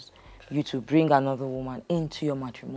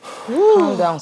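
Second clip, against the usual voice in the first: sharply raised, much higher pitch; urgent calm down; 10 semitones higher, 6 dB louder than usual. Acted. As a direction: cut in to stop the fight. Counselor intervening in a shouting match.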